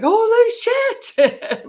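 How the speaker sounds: very high, loud, drawn-out slow gasp; mock-shocked crowd reaction; amazed exclamation. People reacting to her video, high and amazed.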